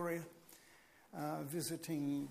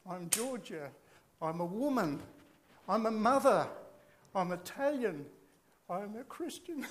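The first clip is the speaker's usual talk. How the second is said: rising, swelling emotional quote, louder on mother; 2 semitones higher, 5 dB louder than usual. Retold or imitated. Preacher quoting Meloni's speech, voice swelling with emotion. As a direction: let the feeling build through each line. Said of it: "Pardon me for getting emotional".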